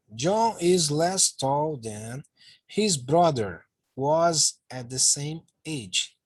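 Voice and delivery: very slow, word by word English; slow model reading. Shown as a demonstration. Teacher reading the example sentence to students, very slowly. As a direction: dictate it slowly for the class.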